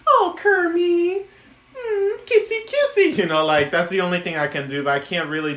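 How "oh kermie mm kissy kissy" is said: high, slow swooning Piggy; high cooing Miss Piggy. Pretend. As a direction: coo at Kermie like a smitten diva. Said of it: "only Frank Oz voice ... Miss Piggy".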